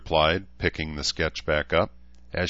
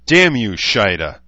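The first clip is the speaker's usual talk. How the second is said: loud, angry outburst; furious curse; 3 semitones higher, 9 dB louder than usual. A narrator's reading. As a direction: curse her with sudden force. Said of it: "jaw worked furiously".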